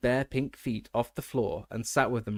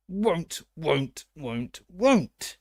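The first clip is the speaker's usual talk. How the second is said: croaking elf, louder and higher; stubborn elf; 5 semitones higher, 4 dB louder, much slower than usual. A narrator's reading. The elf refusing Harry, a croaking whine. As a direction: wail it like a defiant elf.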